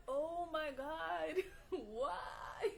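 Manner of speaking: slow, drawn-out stunned cry with a laugh; telling how she reacted to lacking travel papers, stunned slow cry; shocked oh my God. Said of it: "And then I was like".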